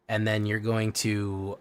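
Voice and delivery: usual speaking voice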